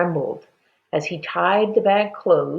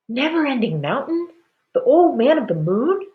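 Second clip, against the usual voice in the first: incredulous neighbors; 9 semitones higher, 3 dB louder than usual. A narrator's reading. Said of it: "the neighbors said".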